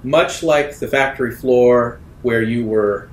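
usual speaking voice

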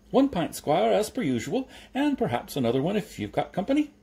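high-pitched, chirpy tradesman patter; cheery milkman. A narrator's reading. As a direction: chirp it out to a regular customer. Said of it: "said a cheery voice".

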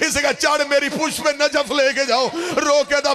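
high, urgent, wailing sermon delivery; impassioned lament preaching. Acted. Majlis speaker narrating the horse and Hussain to mourners, impassioned lament.

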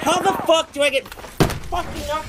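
high-pitched, fuming outburst; real gamer rage. Real, not acted. Gamer about to break something, real rage. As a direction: rant in exasperation, pitch up high. Raging after being knocked off.

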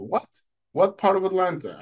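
high, eager astonishment; retold surprise. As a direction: question a stranger in amazement. Astonished at coincidence.

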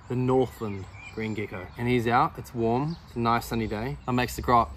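normal talking voice

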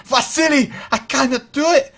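put-on high, loud panicked Soviet accent; bad Soviet accent. Scared soldier pleading to a comrade, panicked mock Soviet accent. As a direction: wail it in a hammy Soviet accent. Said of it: "meant to be a Soviet accent".

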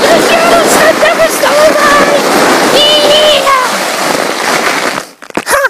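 loud, high triumphant battle cry; heroic high shout. Acted. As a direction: shout it like a hero. Triumphant character line.

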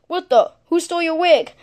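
usual speaking voice

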